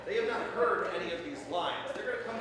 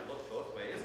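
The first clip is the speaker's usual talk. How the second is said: quiet repeat; 9 semitones lower, 8 dB quieter than usual. Shown as a demonstration. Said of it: "our next line".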